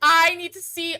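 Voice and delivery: loud, drawn-out fangirl shouting; thrilled, slow emphatic yelling at a fellow fan; excited. Real, not acted. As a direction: shout it out high, bursting with excitement. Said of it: "I'm so excited!".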